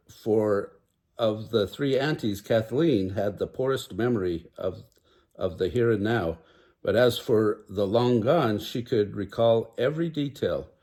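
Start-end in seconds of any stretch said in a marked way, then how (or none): none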